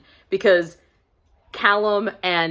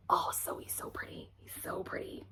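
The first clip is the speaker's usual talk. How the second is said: hushed whisper; 11 semitones higher, 17 dB quieter than usual. Real, not acted. Gushing about the cover model in a whisper. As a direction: whisper it breathlessly, like a secret crush.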